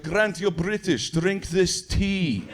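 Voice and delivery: very slow, deliberate imitation of a sinister host; mock Russian agent. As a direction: offer it slowly, with veiled menace. Imitating Russian agents offering tea.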